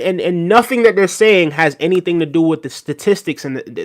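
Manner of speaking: ordinary talk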